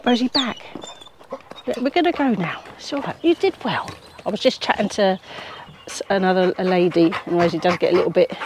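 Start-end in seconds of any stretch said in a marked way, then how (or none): 0.0-3.9 higher, sing-song praise for the dog; coaxing a pet up high; dog talk. Real, not acted. Owner soothing her excited dog, high and coaxing.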